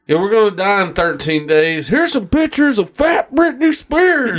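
mock newscaster. Mocking the movie's news media.

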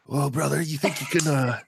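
lightly voiced laid-back buddy begging off; a hint of a weary wrestling-podcast pal; mild imitation of a tired friend asking to push it back; Blade voice impression. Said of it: "I'll try and do my best Blade voice".

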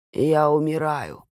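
put-on rasping, dying old man; hoarse wheeze. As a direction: croak it out, short of breath. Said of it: "прохрипел он".

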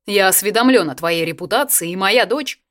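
loud stern lecture; stern father. A narrator's reading. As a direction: scold the boy like a strict officer. Father berating the suitor.

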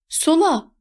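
high, urgent call; called-out direction. A narrator's reading. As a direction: call out the turn, pitched high. Navigator calling out turns while driving. (tiz sesle seslenerek)

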